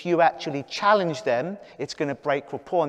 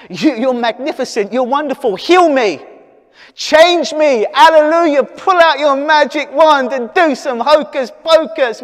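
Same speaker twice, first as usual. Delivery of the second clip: higher and louder, rising into a mock hallelujah rapture; over-the-top worshipful plea, higher and louder; gushing adoring client; 9 semitones higher, 9 dB louder than usual. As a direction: play the starstruck client begging to be healed by magic.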